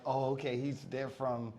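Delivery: low, soft imitated reaction; subdued acknowledgement. People learning which part someone is from, subdued. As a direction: take it down, quietly sizing someone up.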